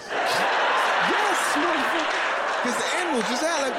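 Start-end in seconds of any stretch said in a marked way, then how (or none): 0.1-2.6 high, slow, drawn-out confirmation; slow emphatic yes. Retold or imitated. Friend confirms the polar bear, high and slow. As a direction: drag the curse out, high.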